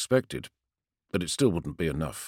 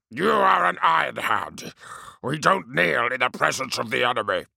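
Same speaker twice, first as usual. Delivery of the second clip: stern captain; 4 semitones higher than usual. Acted. Captain rebukes kneeling soldier.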